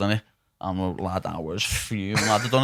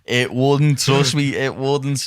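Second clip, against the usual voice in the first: insistent retort; 3 semitones higher, 5 dB louder, much slower than usual. Retold or imitated. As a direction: insist hard that it wouldn't. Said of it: "I'm going".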